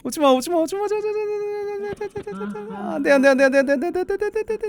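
very high rapid-fire pleading, thinning out; panicked squeal. Real, not acted. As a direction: squeal the words over and over in panic. Enemy closing in, begging it away.

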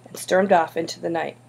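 ordinary delivery, nothing marked

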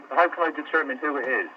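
usual speaking voice

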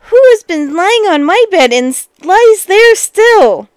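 high, loud little bear amazement; astonished tiny voice. A narrator's reading. Tiny bear finding someone in bed, astonished tiny voice. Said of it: "tiny voice of astonishment".

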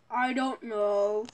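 low, slow, quiet reply. Acted. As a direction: answer slowly in a deep, soft voice. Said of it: "Why's your voice deeper?".

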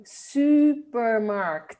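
supermarkt, very slow, long rounded Dutch U in "su"; model pronunciation. Shown as a demonstration. Teacher modelling "supermarkt", slow, long double-U sound. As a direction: stretch "supermarkt" with a "süü" opening. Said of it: "suena como doble U".

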